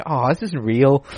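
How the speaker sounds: disappointed waking-up realisation, acted out; retold disappointment. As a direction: draw it out like a mock-crushed dreamer. Waking from a nice dream.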